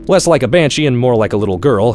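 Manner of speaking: plain reading voice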